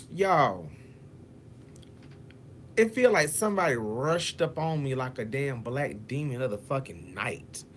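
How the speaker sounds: ordinary delivery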